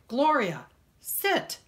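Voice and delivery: sharp, high call to the dog; firm command. Acted. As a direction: order the dog to sit.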